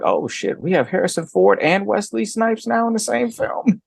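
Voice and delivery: retold amazement climbing to a high pitch; amazed. Fan reacting to two stars in the same film, amazed.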